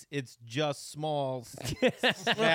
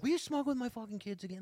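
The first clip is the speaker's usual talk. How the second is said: imitating brother; 8 semitones higher than usual. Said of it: "my brother'd be like".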